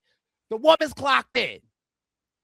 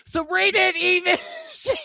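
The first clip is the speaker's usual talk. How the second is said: normal talking voice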